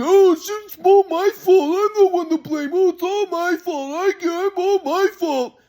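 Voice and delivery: high, slow, mocking self-pity; sarcastic grandpa. Grandson mocks grandpa's sarcastic self-blame, slow and high. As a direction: drag out the martyr act. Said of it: "sarcastic and".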